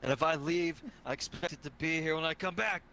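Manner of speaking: stern warning. Acted. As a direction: lay down the law to the room. Player warns others off claimed room.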